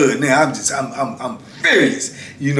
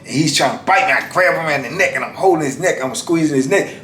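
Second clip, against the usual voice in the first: ordinary talk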